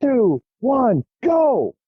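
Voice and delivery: high, called-out countdown; jump master countdown. Retold or imitated. Jump master counting down the jumpers, high and calling. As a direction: count them out of the plane. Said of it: "the jump master finally goes".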